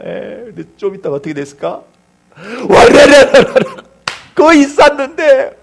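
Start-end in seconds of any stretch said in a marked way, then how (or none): 2.7-3.5 loud, high rattling tumble noise; imitated crash. Preacher mimics the boy's tower collapsing, imitated crash. 4.3-5.5 high, loud, slow lament; mock anguish. Preacher tells congregation the tower fell, mock anguish.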